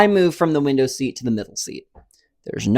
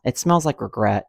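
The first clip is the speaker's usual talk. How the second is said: normal talking voice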